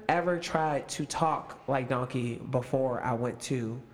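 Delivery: usual speaking voice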